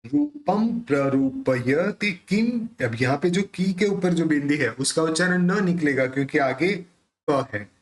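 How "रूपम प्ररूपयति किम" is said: रूपम ending in a clear म, slowly; slow pronunciation demo. Shown as a demonstration. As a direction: sound out the line syllable by syllable. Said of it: "उसका उच्चारण अपन म करेंगे".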